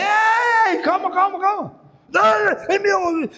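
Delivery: loud, high calls of calma; retold shout to calm. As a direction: shout to break up the fight. Apartando briga, gritando. (gritando para apartar a briga)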